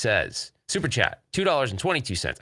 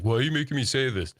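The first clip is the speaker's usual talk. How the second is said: lightly imitated friend's accent in protest; mock complaint in a friend's accent; Wicked Gamer and Collector; 3 semitones higher than usual. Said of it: "with his accent".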